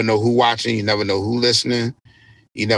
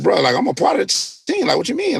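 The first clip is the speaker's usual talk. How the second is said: high-pitched, baffled retold protest; puzzled protest; 10 semitones higher than usual. Artist retelling how he questioned his team invitation, puzzled high protest. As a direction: jump up high as if not following.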